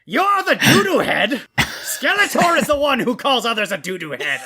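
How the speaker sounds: high indignant villain squeak; squeaky cartoon villain comeback; Skeletor voice. Skeletor retorting to insult.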